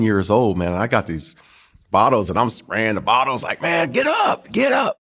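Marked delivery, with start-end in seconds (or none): 3.6-5.0 high-pitched mock shout without much volume; excited imitation yell, pitched way up; retold rookie yell. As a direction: act out calling teammates up to celebrate. Rookie yelling at crying veterans.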